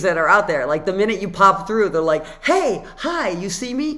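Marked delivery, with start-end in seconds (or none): 2.4-4.0 high-pitched, pushy hello from imagined beings; eager greeting. Acted. Beings greet a newcomer popping through, eager greeting. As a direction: call out brightly, high and a little loud.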